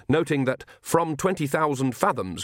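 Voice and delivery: plain reading voice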